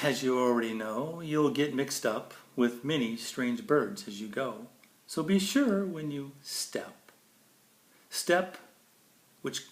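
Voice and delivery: bright, lilting storybook read; sing-song picture book reading; reading to children. Acted. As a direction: read it like bedtime verse for a child. Reads Dr. Seuss to kids.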